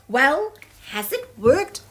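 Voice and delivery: loud, eager question; eagerly asking. Acted. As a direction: ask hopefully, leaning in. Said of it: "asked Tiny eagerly".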